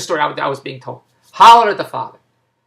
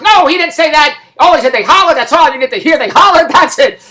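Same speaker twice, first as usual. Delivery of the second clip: high, loud, insistent retort; exasperated denial; 8 semitones higher, 7 dB louder than usual. Retold or imitated. Daughter insisting her father said nothing more, exasperated. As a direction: cut off the questioner in mock exasperation. Friend denies, fed up with questions.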